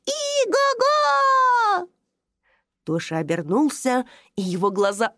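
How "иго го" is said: high, stretched, playful neigh; horse neigh. Acted. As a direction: neigh like a pretend pony. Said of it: "раздалось лошадиное ржание".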